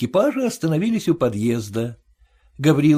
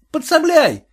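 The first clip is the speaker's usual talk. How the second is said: calling out; 9 semitones higher than usual. A narrator's reading. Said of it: "крикнул он кучеру".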